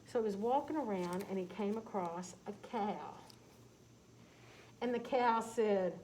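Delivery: usual speaking voice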